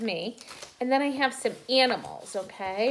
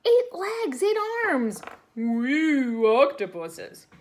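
excited; 3 semitones higher, 7 dB louder, slower than usual. Acted. Counting octopus arms, excited.

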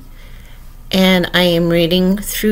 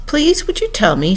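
timid, higher-pitched request; 8 semitones higher than usual. A narrator's reading. Alice approaching the Red Queen, timidly. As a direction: ask politely in a small high voice. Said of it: "looking timidly at the Red Queen".

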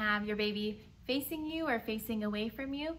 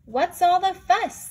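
higher, louder amused mother owl; 8 semitones higher, 10 dB louder than usual. Acted. Mother owl teasing her excited babies. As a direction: tease the little ones gently. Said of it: "owl mother asked".

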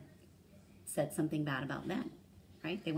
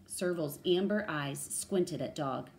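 usual speaking voice

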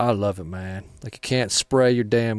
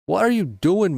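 exasperated call-out; 8 semitones higher, 3 dB louder than usual. Real, not acted. Yelling at a teammate bot.

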